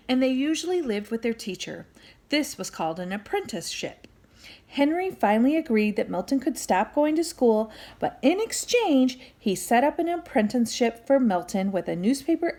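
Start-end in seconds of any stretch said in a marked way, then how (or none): none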